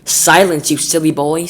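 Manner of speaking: loud, sharp rebuke; stern nanny scolding. Acted. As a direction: scold him like a naughty child. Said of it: "said Miss Tibbs".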